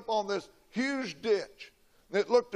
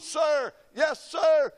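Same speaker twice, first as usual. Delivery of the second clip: higher, louder recruit's shout of sir, yes, sir; hollered reply; 8 semitones higher, 5 dB louder, much slower than usual. Retold or imitated. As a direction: bellow it back at the drill sergeant like a motivated recruit. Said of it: "At the top of my lungs, I hollered".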